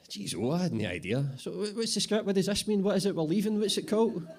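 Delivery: totally lost, firing questions; baffled boss Bobby. Acted. Bobby the boss asks what they're leaving, high and baffled. Bobby learns about Brexit.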